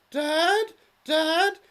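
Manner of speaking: very high, loud pleading daughter calling Dad; high girlish plea; squeaky daughter. Acted. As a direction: whine it up like a lovesick girl. Daughter's ghost calls again.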